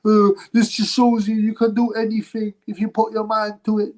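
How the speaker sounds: high mock-tearful whine; mock crying. Streamer mocking the boxer's inspirational speech, mock crying. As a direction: put on a blubbering high voice. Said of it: "and he starts crying".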